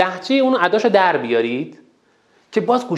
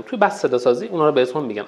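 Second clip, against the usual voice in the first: ordinary talk, nothing marked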